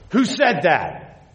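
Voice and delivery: drawn-out, demanding question; sharp challenge. Acted. Khrushchev confronting the unseen heckler, challenging. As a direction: demand it slowly, pitch dropping at the end.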